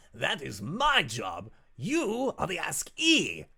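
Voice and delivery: high, loud, fast demon lord cutting him off; gleeful tyrant lording it over guests; theatrical demon lord. Acted. GM voices demon Rakdos.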